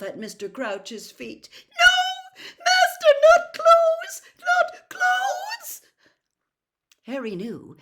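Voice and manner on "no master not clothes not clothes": high, very loud sobbing shriek; shrieking elf. A narrator's reading. House elf begging at her master's feet, sobbing shriek. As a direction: scream it in despair. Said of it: "shrieked Winky".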